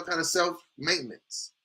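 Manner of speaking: usual speaking voice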